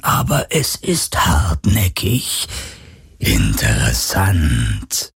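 slow, low, eerie spirit; phantom voice. Acted. The phantom tells Galen his thoughts are shielded, slow and eerie. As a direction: muse coldly like a curious ghost.

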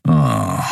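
low, scornful "ah"; disdainful low sigh; contemptuous grunt. A narrator's reading. Keru hearing the declaration of love, contemptuous grunt. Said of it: "ein verächtliches".

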